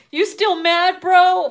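high, loud mocking taunt. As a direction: tease them harder, pitch up. Repeated taunt, higher.

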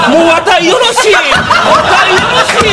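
put-on high, laughing old-woman voice; high mock grandma voice, laughing; old lady giving up. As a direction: squeak it out like a giving-up granny. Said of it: "言うてはるんですよ".

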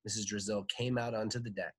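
ordinary delivery, nothing marked

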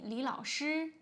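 teacher reading a dialogue aloud slowly; slow model reading. Shown as a demonstration. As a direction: read the dialogue slowly and clearly. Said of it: "now let's read".

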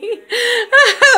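a sudden, high, loud burst of laughter. Real, not acted. Wrapping up her video, she bursts into a high laugh.

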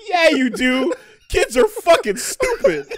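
laughing. Real, not acted. Cracking up at the kids video.

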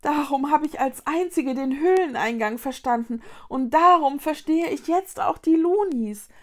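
high, rising in volume; shocked realisation. A narrator's reading. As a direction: exclaim as it dawns on you. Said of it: "begriff Vicky schockiert".

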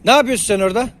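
loud, high call across the yard. Real, not acted. Owner calling a hen back from the field, high loud call. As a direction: call her over from afar.

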